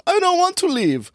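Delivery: very high boy's voice with a mock German accent. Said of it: "I don't know why I'm making him German".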